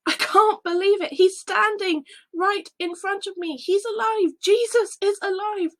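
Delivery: high, loud joyful exclamations; overjoyed. Acted. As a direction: burst out with joy. Seeing risen Jesus.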